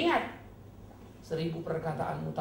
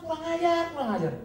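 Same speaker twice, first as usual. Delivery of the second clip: indignant retort; 13 semitones higher, 7 dB louder than usual. Retold or imitated. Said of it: "Saya bilang".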